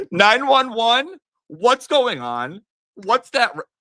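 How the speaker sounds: high, loud, drawn-out mock alarm; hammed-up shrieking online crowd; panicking internet. Streamer mocking internet sleuths over a rock, in exaggerated high-pitched panic. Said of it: "all the internet was like".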